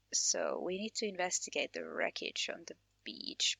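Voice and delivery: normal talking voice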